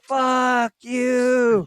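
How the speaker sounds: high shouted insult; mock shout. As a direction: yell it at him across the sky. Navy pilot insult, repeated louder.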